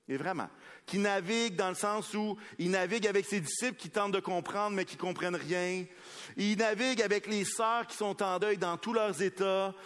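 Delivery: high, loud preaching over the music; impassioned preaching. Acted. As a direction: build the wave of each phrase. Preaching at full force over music.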